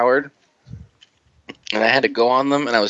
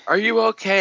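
lightly voiced high-pitched worried question; mock concern; 9 semitones higher than usual. Companion sitting next to terrified rider, mock concern.

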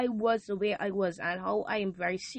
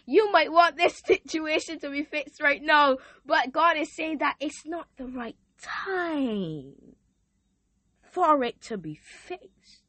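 rising preacher cadence; 5 semitones higher, 4 dB louder than usual. Real, not acted. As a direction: preach it from the pulpit. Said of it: "why you sound like a preacher".

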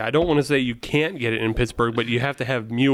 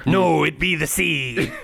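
slow, hammed-up pirate voice; playful pirate punchline; 2 semitones higher, slower than usual. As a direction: growl it like a joke pirate, half laughing. Pirate's answer in a pirate joke.

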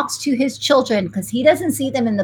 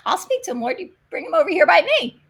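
eager reply to God; 7 semitones higher, faster than usual. Retold or imitated. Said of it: "And I said".

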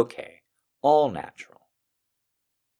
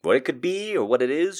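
ordinary delivery

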